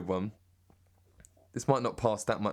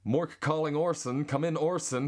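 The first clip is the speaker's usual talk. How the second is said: higher, slower American accent; 7 semitones higher than usual. Shown as a demonstration. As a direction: nail it in a lifted American voice.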